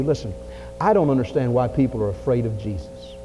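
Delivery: ordinary talk, nothing marked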